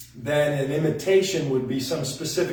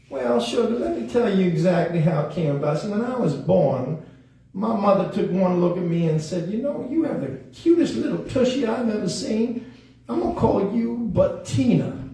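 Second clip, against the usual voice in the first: higher, sugary puppet voice; Bettina; 6 semitones higher than usual. Pretend. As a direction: tell the tale as a sassy puppet. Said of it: "Bettina, how'd you get your name".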